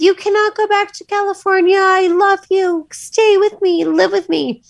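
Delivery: put-on high, pleading child voice; imitating the little niece, high and begging; little girl pleading. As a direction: plead like a small girl begging her aunt to stay. Niece's words quoted, child voice.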